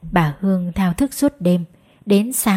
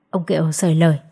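ordinary delivery

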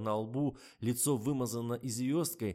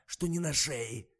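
snapping; 5 semitones higher, 4 dB louder, slower than usual. A narrator's reading. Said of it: "огрызнулся оператор".

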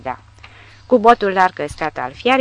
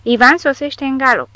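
loud, high warning; alarm call; 7 semitones higher, 5 dB louder than usual. A narrator's reading. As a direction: announce the enemy loudly. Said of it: "îi replică un sergent tanchist".

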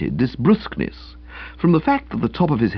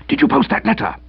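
anxious urgent question; 13 semitones higher, faster than usual. Acted. Uncle anxious high.

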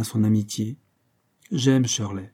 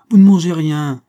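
much louder, higher scold; scolding exclamation; 5 semitones higher, 10 dB louder than usual. Acted. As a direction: chide her loudly for not eating. Shirley scolds Caroline over tea.

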